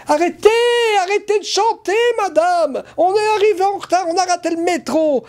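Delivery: high-pitched mock complaint, rushed toward the end; protesting latecomers. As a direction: imitate indignant spectators far above your own pitch. Imitates annoyed latecomers protesting at a singer. (spectateurs râleurs, voix haut perchée)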